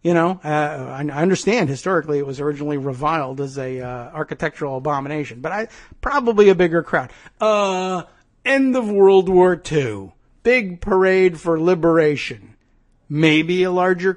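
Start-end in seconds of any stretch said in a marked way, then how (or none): none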